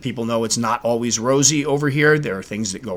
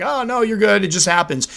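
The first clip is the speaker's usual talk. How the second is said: higher, lightly mocking breezy comfort; airy imitation of well-meaning keepers; mock reassurance; 9 semitones higher than usual. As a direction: parrot the easy reassurance. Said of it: "I know people will be like".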